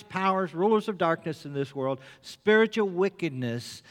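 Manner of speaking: rising preacher's call; preaching. Acted. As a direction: preach it with full conviction. Preaching at full force.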